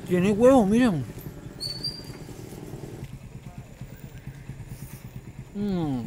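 talking between bites; chewing. Real, not acted. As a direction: mouth full of food. Seen 2: bites pastel at 1.3–2.3, food in mouth 2.8, chews after.

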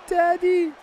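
put-on high, squeaky little girl pleading; little daughter's voice. Dad voicing his daughter begging to go back, high and squeaky. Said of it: "and then they said".

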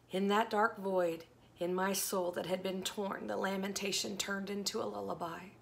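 earnest devotional poetry reading; dramatic poem reading. Acted. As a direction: read it like a heartfelt lament.

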